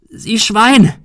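high, loud roar of rage; enraged shout; furious yell. A narrator's reading. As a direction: bellow the insult at him. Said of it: "brüllte sie".

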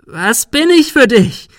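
high, loud, stretched-out screech; shriek. A narrator's reading. Girl screams at the boy who hid, shrieking. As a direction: screech the question at full pitch. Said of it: "Sarah kreischte".

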